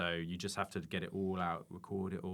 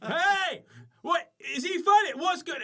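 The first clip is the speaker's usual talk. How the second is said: high, loud, rowdy festival voice; mock punter; 12 dB louder, slower than usual. Comedian mocking punters talking over the act, high and loud. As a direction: bellow like a distracted crowd member.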